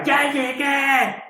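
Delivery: high, drawn-out mock call of the old pastor; imitated shout. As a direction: stretch it out like an old man announcing himself. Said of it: "tenía una voz que llegaba a los eventos y gritaba". (grito imitado, agudo y alargado)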